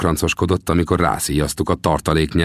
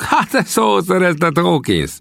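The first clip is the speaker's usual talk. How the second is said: high teasing call at Hawkins; mock call; 12 semitones higher than usual. The men jeeringly call out to Hawkins, high. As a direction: jeer across the camp. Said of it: "kiáltották többen is".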